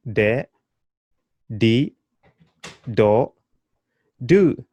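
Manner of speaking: da, de, di, do said crisply one by one; syllable drill. Shown as a demonstration. Teacher drilling da de di do for students. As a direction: clear teacher model of each syllable. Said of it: "How would you say that?".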